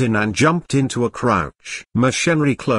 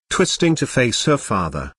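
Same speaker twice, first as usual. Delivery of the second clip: usual speaking voice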